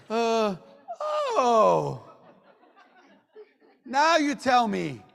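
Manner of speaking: loud, high mock dismay; mock shocked realization. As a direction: stretch it out slowly, as if the news comes too late. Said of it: "some of you are like".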